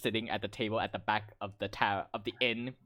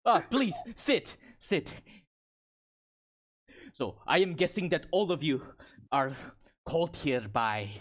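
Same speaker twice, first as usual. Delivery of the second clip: hospitable Goliath woman; 3 semitones higher than usual. Acted. Goliath woman inviting strangers to sit, in character. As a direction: welcome them in as a Goliath warrior.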